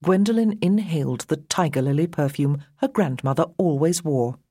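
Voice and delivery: ordinary delivery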